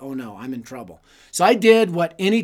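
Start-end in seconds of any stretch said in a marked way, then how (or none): 0.0-1.0 much softer and lower, dread under the breath; quiet dread on seeing Salazar; fearful inner thought. Retold or imitated.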